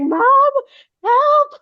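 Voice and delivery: very high, loud mock cry for mom; imitated child's scream. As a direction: mimic a kid screaming for rescue. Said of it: "she's just, like, screaming at me".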